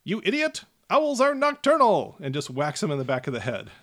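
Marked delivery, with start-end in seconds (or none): 0.0-2.2 high, loud gnome outburst; angry gnome yell. Acted. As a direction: snap at the handler in a high shout. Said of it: "and he says".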